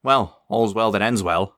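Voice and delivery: smug grin; grinning. Acted. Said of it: "grinned Eunice".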